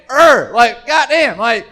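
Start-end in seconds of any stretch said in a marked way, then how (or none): 0.1-0.5 loud angry grunt; loud frustrated growl. Retold or imitated. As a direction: growl it out, fed up. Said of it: "but, like, fucking".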